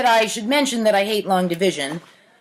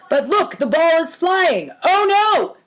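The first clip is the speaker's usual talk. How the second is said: rising alarm, much higher on oh no; excited; 6 semitones higher than usual. Acted. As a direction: panic as the ball flies off. Tennis poem, ball flies.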